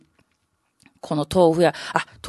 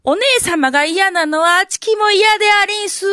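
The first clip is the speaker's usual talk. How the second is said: a hint of a prim young oiran; younger courtesan; 9 semitones higher than usual. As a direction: copy big sister with a coy pout. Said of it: "神代は言うことを聞かんとな".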